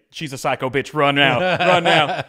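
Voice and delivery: loud, slow, laughing mock warning; imagined host warning them to run, laughing. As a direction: ham up a panicked warning through laughter.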